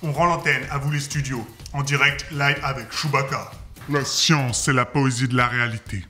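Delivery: low, booming distorted voice; deep gas voice. Shown as a demonstration. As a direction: speak after inhaling heavy gas. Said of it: "professeur Chewbacca".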